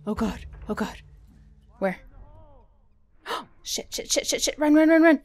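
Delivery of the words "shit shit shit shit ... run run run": panicked shouting. Real, not acted. Fleeing enemy in game.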